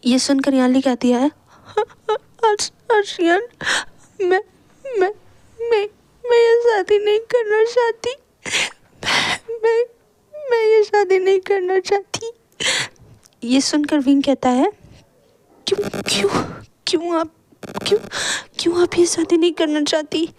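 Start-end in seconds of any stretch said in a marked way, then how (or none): 2.5-12.3 high, broken crying with halting repeats; sobbing. A narrator's reading. Weeping bride confessing she doesn't want this wedding, sobbing. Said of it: "ज़ोर ज़ोर से रोने लगती है". 15.7-20.3 high, halting, stammered question; shaken. A narrator's reading. Brother asking the weeping bride why, shaken and halting.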